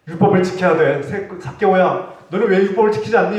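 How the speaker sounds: loud scolding voice, pushing the law; mock rebuke. Preacher scolding the tax collector, loud mock rebuke. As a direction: scold the sinner like a strict preacher. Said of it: "책망하지도 않으셨어요".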